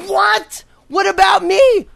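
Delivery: mock wounded shout; indignant shout. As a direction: cry out like a cheated customer. Outraged customer protest.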